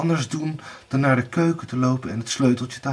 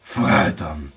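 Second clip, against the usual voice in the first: low, grudging growl; 9 semitones lower than usual. A narrator's reading. Blauwbaard grudgingly agrees, growling. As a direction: growl your consent. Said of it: "gromde Blauwbaard".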